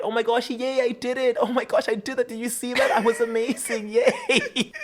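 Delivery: high, giddy self-congratulation; mock excited. Witch cheering her working spell, high and giddy. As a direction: squeal over your own success, half-mocking. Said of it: "And then I got it right".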